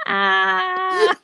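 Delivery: high pained vampire cry; hissing feral vampire. Pretend. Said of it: "That's my vampire impression".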